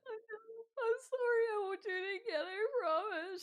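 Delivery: put-on high, sobbing teen apology; ugly crying. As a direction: blubber through tears, promising. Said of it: "ugly crying".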